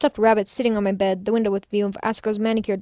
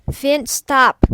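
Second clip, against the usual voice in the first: sharp loud call to a misbehaving cat; real loud scolding of a pet; yelling at the cat; 2 semitones higher, 6 dB louder than usual. Real, not acted. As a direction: snap a command at your cat, loud. Scolding her cat mid-reading.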